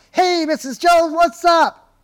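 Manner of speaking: very high, loud, over-hyped sales greeting; hammed-up hype, like a stage motivator on the phone; all fired up. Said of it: "you get all fired up".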